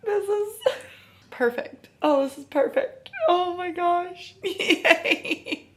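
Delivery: high, teary, laughing through tears; tearful joy. Real, not acted. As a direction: overcome at the happy ending. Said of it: "I have a feeling I'm gonna cry".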